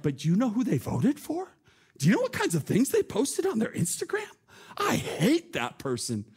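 mock the self-righteous judge, drawing out the end; scornful gossip. Mocking how we judge others.

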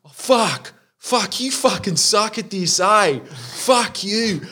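high-pitched mock cursing; imitated griping customer. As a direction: escalate from request into hammed-up abuse. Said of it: "He's just sitting there being like".

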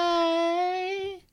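high, flirty sultry greeting; sultry hey. Acted. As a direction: purr it like a seductive cartoon. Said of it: "and it's going".

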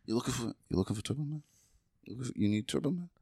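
quick, hushed shady street dealer. Retold or imitated. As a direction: sell it sideways like a back-alley hustler. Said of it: "He's like".

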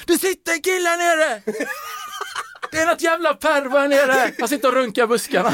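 high shrieking yell, mimicking a panicked student; retold screaming student. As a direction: shout it out to the whole crowd in a high shrill yell. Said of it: "bara börjar skrika mot alla". (hög, skrikande student som larmar alla)

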